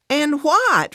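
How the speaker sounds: high, loud indignant retort. A narrator's reading. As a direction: fire it back, pitched right up. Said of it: "Fran raises her voice".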